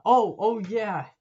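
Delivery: loud, drawn-out excited remembering; a real 'oh yeah!' as it comes back to him; sudden recall. Real, not acted. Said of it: "I can't believe I almost forgot this".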